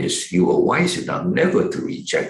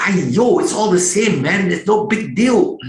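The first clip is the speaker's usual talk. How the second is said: dismissive; 6 semitones higher, 5 dB louder than usual. Retold or imitated. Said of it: "to me, it's like".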